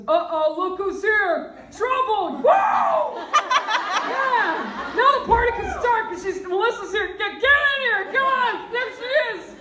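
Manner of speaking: booming, high, rowdy uncle shout; hammed-up loud uncle. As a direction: greet her like a loud uncle at a party. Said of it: "He always goes".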